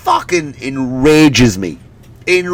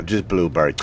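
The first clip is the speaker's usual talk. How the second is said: put-on bored teen; 8 semitones lower, 7 dB quieter than usual.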